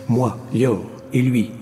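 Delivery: usual speaking voice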